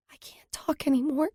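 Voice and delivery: high, breathy frightened whisper; barely audible whisper. A narrator's reading. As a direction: whisper so softly it barely carries. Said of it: "her voice so soft I barely hear it".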